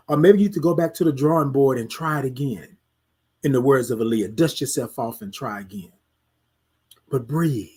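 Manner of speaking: low, settling reassurance; reassuring self-talk. Acted. Speaker telling self to try again, low reassurance. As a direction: bring it down low to comfort yourself.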